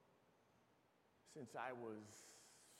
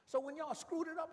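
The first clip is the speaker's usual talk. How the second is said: high, loud chiding cry; preaching shout; 10 dB louder than usual. Real, not acted. Preacher scolding his congregation in a loud high cry. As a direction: call out their failure from the pulpit.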